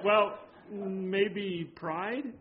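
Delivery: very slow, careful offering; hesitant suggestion. Retold or imitated. Pastor gently answering the upset lady, very slow. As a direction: ease the word out gently and slowly.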